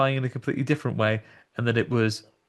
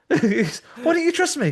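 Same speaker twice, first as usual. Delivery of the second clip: much higher, wounded; mock offended actor; 12 semitones higher, 4 dB louder than usual. Scare actor reacting to distrust, much higher and hurt. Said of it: "scare actor's reply".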